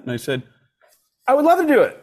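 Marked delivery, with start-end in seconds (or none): 1.3-2.0 very high, gushing mock eagerness; over-eager acceptance. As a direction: jump at the offer in a pitched-up voice. Retold eager yes to his idol.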